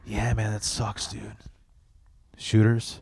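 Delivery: to his heartbroken brother, an offhand deadpan brush-off; deadpan dismissal. Retold or imitated. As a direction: shrug off his heartbreak, then pitch lunch. Callous reply to heartbroken brother.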